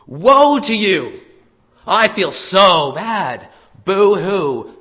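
loud, slow, high mock lament; mocking woe. Jesus mocks the Pharisees in a loud slow fake lament. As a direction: ham up the grief to ridicule them. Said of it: "he does this by mocking them".

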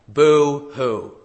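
loud taunting fake sob; mock boo hoo. Preacher mocks the Pharisees with a loud fake boo hoo. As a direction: sneer it like a fake cry.

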